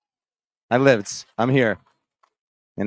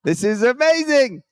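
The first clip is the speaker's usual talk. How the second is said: excited; 10 semitones higher, 6 dB louder than usual. Retold or imitated. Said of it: "I said".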